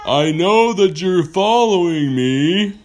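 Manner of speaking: loud, slow, drawn-out whale call; big whale voice. Acted. Whale offering pirates a ride, loud stretched call.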